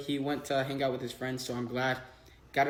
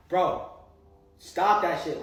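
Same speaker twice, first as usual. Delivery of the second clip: retold firm warning; 3 semitones higher, 7 dB louder, slower than usual. Pushing off an unwanted advance.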